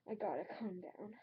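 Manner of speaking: hushed, fast, almost under the breath; a last rushed whisper to settle yourself; whispered self-calming. Acted. Ranting reviewer trying to calm himself, hushed whisper. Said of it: "I am just so furious".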